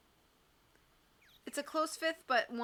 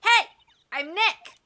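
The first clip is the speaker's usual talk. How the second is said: raised-voice self-introduction, louder and higher; louder call; 8 semitones higher, 14 dB louder, slower than usual. Acted. Nick trying to trigger the noise-activated camera, louder call. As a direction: call it out to be picked up by a microphone. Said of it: "Maybe I'm not saying it loud enough".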